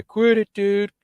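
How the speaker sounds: high, loud pleading yell; high-pitched mock protest; urgent protest. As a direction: imitate a friend frantically begging you to stop. Friend yelling to stop, retold.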